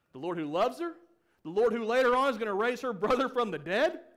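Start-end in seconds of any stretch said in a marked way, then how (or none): none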